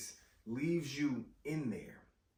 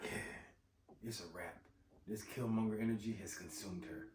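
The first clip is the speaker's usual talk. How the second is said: much lower and much softer, dark; hushed foreboding; hushed ominous voice; 8 semitones lower, 11 dB quieter than usual. Retold or imitated. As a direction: whisper it like she's gone dark. Fan narrating her dark turn.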